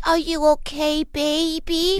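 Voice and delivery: high, cooing mock concern; patronizing high-pitched sweetness; condescending baby talk. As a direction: coo it as if to an infant. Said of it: "not like".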